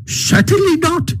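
very high, insistent refusal; emphatic. A narrator's reading. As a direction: fling the refusal out high and sure. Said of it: "replied Toad emphatically".

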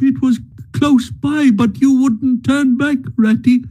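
high, tearful pleading of a grieving mole. Acted. Mole pleading with Ratty about his lost home, tearful and high. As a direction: let the grief spill out in a high, wavering voice. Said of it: "sobs again took full charge of him".

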